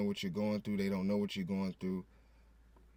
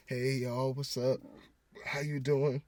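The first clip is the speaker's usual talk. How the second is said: upbeat front for the camera, pitched up and louder; fake cheerful greeting; 4 semitones higher, 3 dB louder, slower than usual. Greeting viewers while hiding hurt at night, falsely cheerful. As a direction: put on a higher, smiling on-camera hello. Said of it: "when the camera comes on, I'm smiling".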